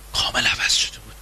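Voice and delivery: hushed secret whisper; gossipy whisper. Acted. Telling friends the spouse changed, in a whisper.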